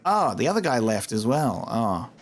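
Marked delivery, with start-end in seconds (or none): none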